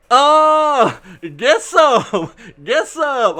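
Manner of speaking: high, loud gleeful whoop broken by a laugh; battle cry again. Pretend. YouTuber gloating over the rumor coming true with a laughing whoop. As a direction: cheer like you just won, then crack up. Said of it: "Okay, sorry, sorry. I won't do that anymore".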